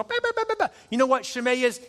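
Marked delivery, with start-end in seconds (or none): 0.0-0.7 very high squeaky yaps; chihuahua yapping. Retold or imitated. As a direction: imitate a tiny dog yapping. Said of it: "He is a chihuahua barking".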